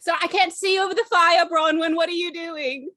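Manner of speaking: loud call across the fire. Acted. Companion calls to Bronwyn over the fire, calling out. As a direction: raise your voice to reach her.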